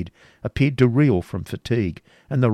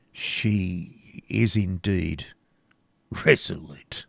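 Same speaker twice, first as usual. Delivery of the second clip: slow and heavy; 2 semitones lower, much slower than usual. A narrator's reading. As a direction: let each word drag with reluctance. Bois Guilbert answers darkly.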